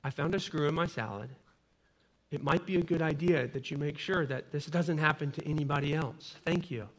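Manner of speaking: quietly kind, no trace of anger; kind and compassionate. Retold or imitated. Father-in-law calmly telling the manager about a screw in his salad, softly kind. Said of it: "in the most kind and compassionate way".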